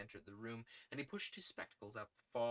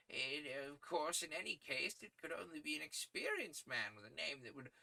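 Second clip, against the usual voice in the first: put-on high, thin voice of a kindly old editor; 6 semitones higher than usual. Editor praising young Malone's articles, high old-man voice. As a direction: pitch him up as a fussy old newsman.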